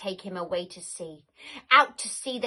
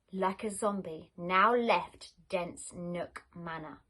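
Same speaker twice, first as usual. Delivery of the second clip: usual speaking voice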